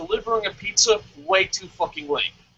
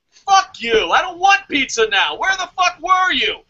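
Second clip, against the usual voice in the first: shouted, higher-pitched fury at the late delivery; mock angry rant; 7 semitones higher than usual. Fan yelling at the late pizza guy, angry shout. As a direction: yell at the pizza guy like he ruined the night.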